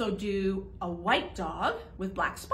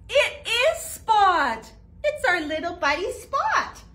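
louder, higher, very slow joyful cry; excited reveal; 9 semitones higher, 6 dB louder, slower than usual. Acted. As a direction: announce the find with delight. Spot found behind the basket.

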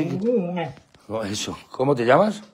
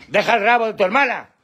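high, exasperated telling-off of a dog; scolding; 8 semitones higher, 3 dB louder than usual. Real, not acted. Owner telling Balto to leave his sister's tail, scolding. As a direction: scold a naughty pet sharply.

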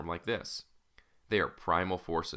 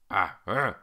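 loud imitated ape grunts; baboon grunts; 6 dB louder than usual. A narrator's reading. Baboon answering his master's order, loud grunts. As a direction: grunt twice like an obedient baboon. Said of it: "the baboon grunted".

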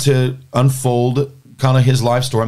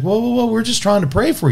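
alarmed whoa; 12 semitones higher than usual. Retold or imitated. Jim calms an angry man.

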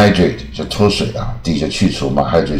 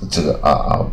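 normal talking voice

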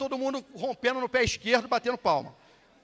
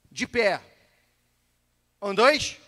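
firm, louder drill order; drill command; 7 dB louder, much slower than usual. Shown as a demonstration. Instructor rehearses the standing order with the class, drill command. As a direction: give the order to stand, not yet full force. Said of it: "E aí eu vou falar assim".

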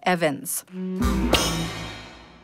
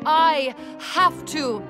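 slow, drawn-out stage declaration; dramatic resolve; 9 semitones higher, much slower than usual. Acted. Heroine resolves to save Christmas, slow melodrama. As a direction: stretch each word for effect.

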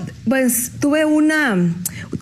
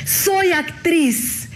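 very slow, proudly drawn-out and higher; 5 semitones higher, much slower than usual. Retold or imitated. Fresh graduate proudly tells the lady, stretched out. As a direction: savor each word like a proud new graduate. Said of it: "orgullosísima".

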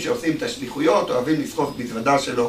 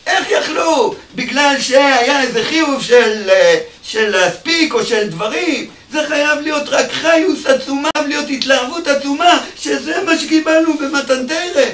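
fiery preaching; 8 semitones higher, 5 dB louder than usual. Acted. Preacher urging joy in Torah study, fiery and loud. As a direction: preach it with full fervor.

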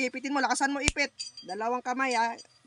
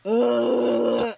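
loud groaning grunts; 7 dB louder than usual. Real, not acted. Healer grunting loudly over the patient in trance. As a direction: push out each grunt from the chest.

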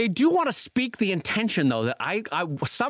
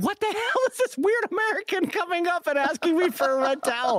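higher, mock-bewildered foreign local; baffled European local; 10 semitones higher than usual. Confused locals asked for a red towel, mock baffled. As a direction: play a puzzled stranger abroad. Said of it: "people would be like".

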